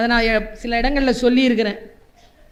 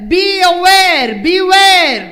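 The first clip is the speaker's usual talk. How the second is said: loud warning; 6 semitones higher, 7 dB louder, much slower than usual. Real, not acted. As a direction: call out the warning, drawn out. Warning children loudly. (உரத்த எச்சரிக்கை)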